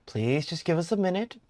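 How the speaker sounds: ordinary delivery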